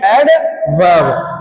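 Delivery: एडवर्ब drawn out very slowly and low, falling end; teacher slowly models एडवर्ब for the class; slow model word. Shown as a demonstration. Stretched-out एडवर्ब for students to repeat.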